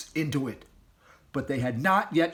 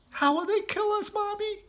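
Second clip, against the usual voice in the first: put-on high little child voice; scared cub asking mother; small frightened fox child. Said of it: "asked one of the small foxes".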